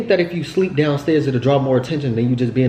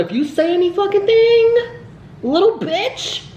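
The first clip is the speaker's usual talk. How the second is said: mock threat. Threatening the little girl who saw them kiss, mock menace. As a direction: play-act scaring the girl off.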